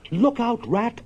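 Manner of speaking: high, urgent cry; alarmed warning. Acted. Mole warning Rat the boat will hit the bank, high alarmed cry. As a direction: yelp a warning before the crash.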